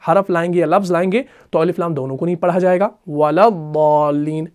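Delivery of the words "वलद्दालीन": "वलद्दालीन" very slow, lam joined to dad; slow melodic recitation; Quranic recitation. Shown as a demonstration. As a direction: draw out "वलद्दालीन" slowly. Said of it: "लाम को हम दौद के साथ मिलाते हैं".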